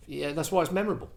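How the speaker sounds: usual speaking voice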